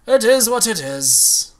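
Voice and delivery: dramatic resignation. Shown as a demonstration. Streamer shrugging off a lost match, dramatic sigh. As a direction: drag it out with mock gravity.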